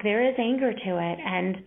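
ordinary delivery

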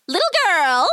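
high, sharp witch voice; sharp witch call. A narrator's reading. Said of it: "she called sharply".

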